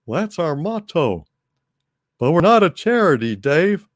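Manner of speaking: lightly voiced Texan Hal scolding a deadbeat; Texan propane salesman. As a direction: lecture Dave like a salesman.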